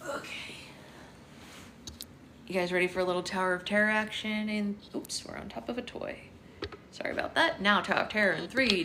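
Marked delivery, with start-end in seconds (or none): none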